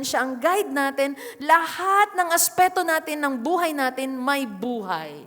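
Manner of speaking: ordinary talk, nothing marked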